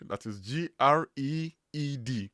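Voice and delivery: ordinary delivery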